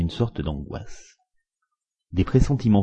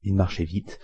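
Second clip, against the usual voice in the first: plain reading voice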